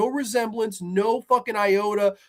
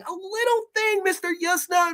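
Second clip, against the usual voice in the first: "a little thing" jumped way higher, mocking; high mock squeak; 11 semitones higher than usual. Repeats phrase in squeaky voice.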